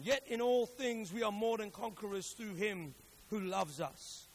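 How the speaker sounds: emphatic preacher's Bible reading; declaimed scripture. Acted. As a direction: build the list phrase by phrase, projected.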